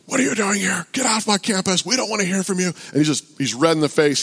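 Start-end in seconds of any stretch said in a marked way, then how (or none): 0.0-2.7 high-pitched mock angry rant; belligerent heckler. Angry man ordering a campus preacher away, imitated belligerent rant. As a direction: imitate a mad man cussing someone out. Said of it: "He's belligerent. He's mad".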